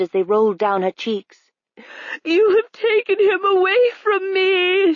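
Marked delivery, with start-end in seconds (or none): 2.2-4.9 high, tearful wail of grief; high crying lament; crying queen. A narrator's reading. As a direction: sob it out as a grieving queen. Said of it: "she cried".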